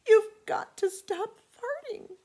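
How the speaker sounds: put-on very high, slow tearful child; desperate plea. A narrator's reading. Betty begging her dog to stop, high and tearful. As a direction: plead with the dog through tears.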